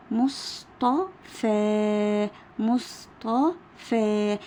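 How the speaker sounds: مصطفى slowly in separated syllables, last vowel held; syllable-by-syllable sounding out. Shown as a demonstration. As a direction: break مصطفى into mus, ta, faa with gaps. Teacher sounding out example word.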